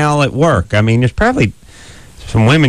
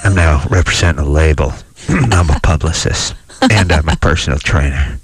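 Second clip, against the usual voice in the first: low, self-important man's voice; guy who got laid; 5 semitones lower than usual. Pretend. Radio host mimicking a virgin caller after he gets laid, deep voice. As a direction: drop down deep and play the big shot. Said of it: "The voice goes down a couple of octaves".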